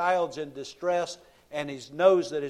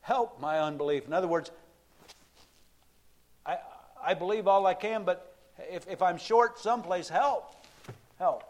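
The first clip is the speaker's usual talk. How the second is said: pleading father; 4 semitones higher than usual. Retold or imitated. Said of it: "and he said".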